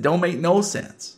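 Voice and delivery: put-on Southern accent waitress giving up. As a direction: toss it off in a Southern twang. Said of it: "Southern one minute and Cajun the next".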